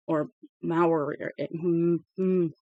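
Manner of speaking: usual speaking voice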